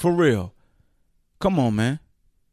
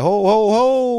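double take; 7 semitones higher, 5 dB louder, slower than usual. Retold or imitated.

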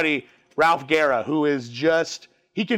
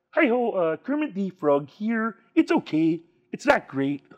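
a slightly off Kermit impression, sung out of the nose; Kermit the Frog impression. Shown as a demonstration. Doing a Kermit impression for the host on request, a lightly voiced Kermit. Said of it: "I do a pretty good Kermit the Frog".

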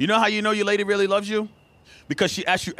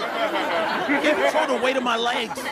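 slow defensive; 4 semitones higher, much slower than usual. Retold or imitated.

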